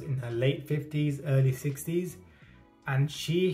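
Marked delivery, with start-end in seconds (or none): none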